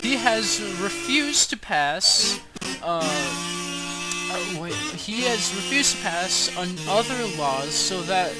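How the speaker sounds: usual speaking voice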